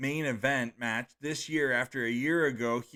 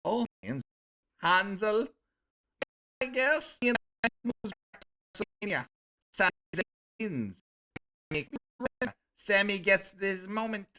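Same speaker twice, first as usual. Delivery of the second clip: silly put-on voice; 7 semitones higher than usual. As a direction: do a goofy voice on purpose. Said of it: "I just felt like doing that voice".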